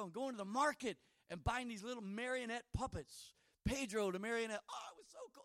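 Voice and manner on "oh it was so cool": delighted memory. Real, not acted. Fond childhood memory.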